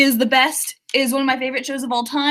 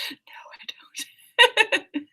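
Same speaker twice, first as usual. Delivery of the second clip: whispered with a giggle, owning up; laughing whisper; 11 semitones higher, 5 dB quieter, much slower than usual. Real, not acted. To her viewers, admitting she's lost, in a laughing whisper. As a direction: breathe it out like a guilty secret. Admits she doesn't know what she's doing.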